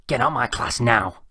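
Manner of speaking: loud, slow teacher's order; angry shout. A narrator's reading. Teacher throws laughing pupil out, angry shout. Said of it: "shouted Mister Sutt".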